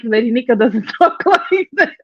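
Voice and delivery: breaking into laughter mid-line; laughing retort. Retold or imitated. Calling out the troll posing as Lady Nika, cracking up. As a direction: let the retold comeback collapse into laughter.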